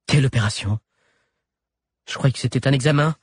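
quick, alarmed heroine; a hint of panic in the questions; alarmed young woman. A narrator's reading. Alix voiced, alarmed.

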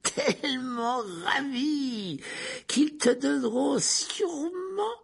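slow taunt climbing to a high, loud mock-sweet wail; gloating, crazed villain. Teacher promises prisoner the dementors' kiss, gloating. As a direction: savour it slowly, rising into mad delight.

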